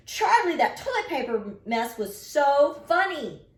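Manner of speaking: put-on high, loud, slow girl's shout across the kitchen. A narrator's reading. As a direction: call it out high and gleeful, slowly. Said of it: "Carla shouted".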